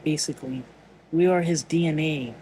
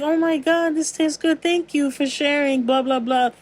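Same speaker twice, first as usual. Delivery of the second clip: delighted taster; 8 semitones higher than usual. Retold or imitated. Friend tastes the shared cake.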